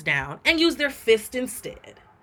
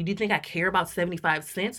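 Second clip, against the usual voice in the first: ordinary talk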